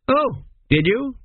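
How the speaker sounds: a touch of dotty astonishment; vague surprise. A narrator's reading. King realising his son was away, mildly surprised. King forgets son's trip.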